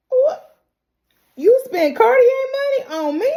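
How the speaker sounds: high, loud gasp of disbelief; shocked delight. Retold or imitated. Elena stunned by Coop's Cartier gift, high surprise. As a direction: squeal it like you can't believe it.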